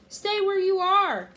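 loud, high, drawn-out warning; long urgent yell; shouting. A narrator's reading. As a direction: stretch out a desperate order. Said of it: "Jack shouted".

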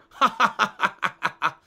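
a longer laugh, falling away; another laugh. Shown as a demonstration. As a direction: laugh out freely.

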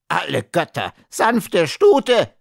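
high, shrieking indignation; little man's shrill outburst; outraged cry. A narrator's reading. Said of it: "schrie der Kleine".

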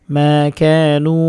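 মা কানু with every vowel held one alif; slow recitation. Shown as a demonstration. Teacher recites মা কানু slowly to students. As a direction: stretch মা কানু slowly and evenly. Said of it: "টানতে হবে এক আলিফ।".

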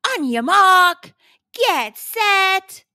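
high, loud race start shout; starter's call. A narrator's reading. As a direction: call the racers to the line.

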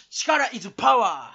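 「力is power」を大声でゆっくり言い切る; 語尾を伸ばして力強く宣言; 芝居がかった標語の叫び. Acted. 標語のように叫ぶ. (力強い絶叫の決め台詞)

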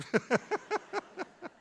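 loud high burst of laughter; real laughing burst. Real, not acted. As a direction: laugh out at your own joke. Laughs at own joke.

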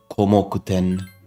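ordinary talk, nothing marked

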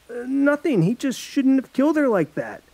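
put-on nervous teen boy. Alex covering up, nervous.

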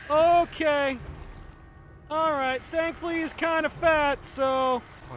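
high, tight self-reassurance; nervous squeak. Real, not acted. Player reassuring himself about a fat pursuer, nervous. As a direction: talk yourself down in a high, anxious voice.

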